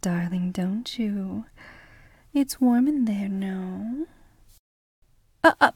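usual speaking voice

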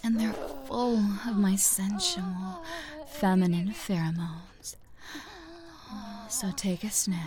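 soft intimate whisper; breathy ASMR whisper. Acted. Erotic roleplay whisper.

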